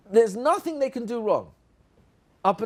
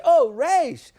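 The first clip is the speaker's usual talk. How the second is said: higher, louder chorus of discovery; children calling out; 7 semitones higher, 6 dB louder than usual. Pretend. Children answering the teacher's sound question, eager higher call. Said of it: "And the children will say".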